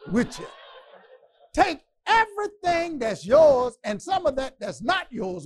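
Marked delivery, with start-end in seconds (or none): 1.5-5.5 high, loud commanding shout; Pharaoh's shout. Acted. Pharaoh ordering Moses off, loud shout. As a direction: roar it out like an angry king.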